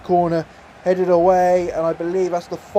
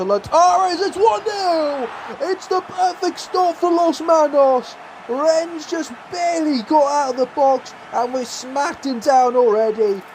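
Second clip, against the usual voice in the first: much higher, jubilant goal call; goal celebration; 9 semitones higher than usual. Real, not acted. Celebrating the opening goal in the Conference League final, jubilant high call. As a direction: shout it like the winning commentator.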